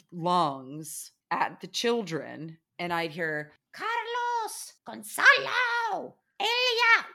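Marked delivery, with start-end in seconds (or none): 3.7-7.1 put-on much higher, long drawn-out calls of the kids' names; mock mother calling. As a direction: call the children like a shrieking mom. Host mother yelling at kids.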